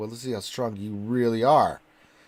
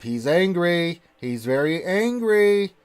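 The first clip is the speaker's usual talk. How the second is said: higher and slower, building up; rising excitement, drawn out; excited building; 7 semitones higher, 6 dB louder, much slower than usual. Real, not acted. Gamer hyping Kylo's growing rage, slow and rising.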